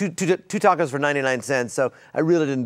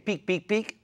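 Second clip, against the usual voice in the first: beeps; 6 semitones higher, 4 dB quieter than usual. Retold or imitated. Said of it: "What's your daughter's nickname".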